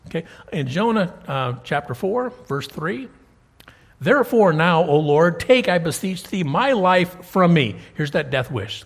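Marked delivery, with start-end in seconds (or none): 4.0-7.8 loud, solemn reading of a plea; loud pleading recitation; declaimed scripture. Acted. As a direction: proclaim it from the pulpit. Reading Jonah's death wish aloud.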